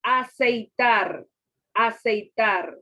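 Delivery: ACEITAR slowly and loudly, a-cei-tar; slow, measured ACEITAR with drawn-out syllables; dictating a word. Shown as a demonstration. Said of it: "divide en sílaba".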